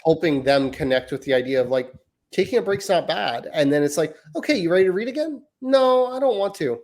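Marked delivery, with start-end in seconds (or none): 4.3-6.8 high-pitched imitation of parent and child; acted parent-child exchange. Parent asking daughter to read again, acted high-pitched. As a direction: lightly mimic a quick question and a refusal.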